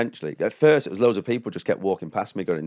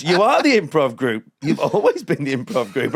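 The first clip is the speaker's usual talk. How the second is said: raised, loud mock-horror pronouncement; ominous revelation; 6 semitones higher, 5 dB louder than usual. Sweeper telling comic he is the group, ominous. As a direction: proclaim it like a chilling twist. Acting out a horror-film style reveal.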